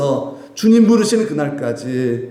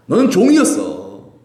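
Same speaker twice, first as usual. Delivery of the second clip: voicing God; 6 semitones higher, 4 dB louder than usual. God's voice voiced in sermon.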